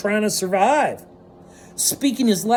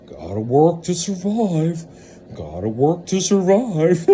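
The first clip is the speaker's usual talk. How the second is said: mock drone; 5 semitones lower than usual. Mocking workers' mindset.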